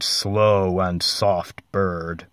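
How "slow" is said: plain reading voice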